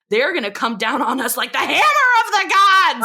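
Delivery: rising to a high, booming finish; dramatic mock warning. Laney warns Peggy about unsold product, hammed-up doom. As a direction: build to a hammed-up cry of doom. Laney's threat, acted big.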